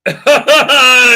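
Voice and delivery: loud, high burst of laughter; real gleeful laugh; delighted laugh. Real, not acted. Vlogger seeing the animation load, delighted laugh.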